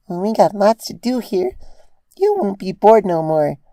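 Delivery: put-on high child's voice, eager; a small child promising fun; bouncy little girl; excited little filly. A narrator's reading. Dinky replying excitedly.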